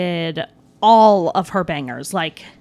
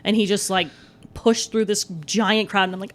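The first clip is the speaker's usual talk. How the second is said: ordinary talk, nothing marked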